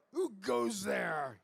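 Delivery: slow, loud guard-dog growl; growled challenge. A narrator's reading. Little dog challenges a creeping tiger, growling. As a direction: growl it out like a small watchdog. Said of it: "He growled".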